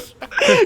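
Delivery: very high, laughing mock scream; imitated screaming. Dad screaming with the two-by-four, hammed-up squeal. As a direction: scream hysterically while cracking up. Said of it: "He's just fucking screaming at him".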